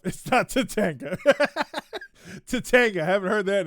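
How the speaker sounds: laughing through the words; chuckling as he reads it out; amused laugh over a chat joke. Real, not acted.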